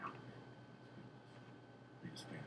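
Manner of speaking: very soft, low offhand reply; imitating Bruce Bruce. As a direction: play him hushed and low-key. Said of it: "He's like".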